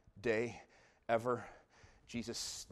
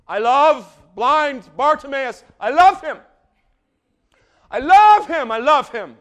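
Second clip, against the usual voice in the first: high, louder, building with each repeat; passionate; 23 dB louder, slower than usual. Real, not acted. Preacher declaring love for Bartimaeus, building passion. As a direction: pour your heart into it.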